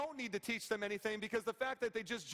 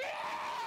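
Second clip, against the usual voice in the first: very high-pitched imitated child's wail; exaggerated crying shriek; mock bawling wail. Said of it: "She started bawling".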